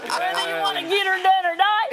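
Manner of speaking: loud, high Texas holler; hammed-up Texas twang; Texas accent. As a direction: whoop it out like a Texan. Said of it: "from Texas, too, so we'd say".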